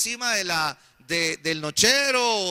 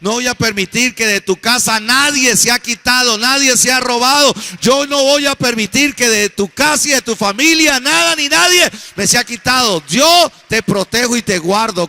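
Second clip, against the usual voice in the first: loud, raised, full-force preaching; preacher voicing God; 4 semitones higher, 11 dB louder than usual. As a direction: cry out God's promise to the congregation. El Señor dice. (predicando a gritos la promesa de Dios)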